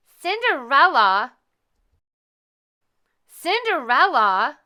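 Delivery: loud, slow, drawn-out calls; calling out. A narrator's reading. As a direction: call her name across the house. Stepmother calling repeatedly.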